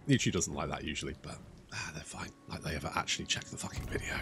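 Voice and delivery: hushed, offhand grumble to yourself; muttered aside. Real, not acted. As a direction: mutter it dismissively under your breath. Dismissive aside about YouTube checks.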